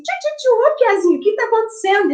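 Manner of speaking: high, fast teasing coo; playful baby talk. Retold or imitated. Mother teasing her sulking son on the sofa, high playful coo. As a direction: coo at a grumpy little boy. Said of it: "dei uma beliscadinha ... assim".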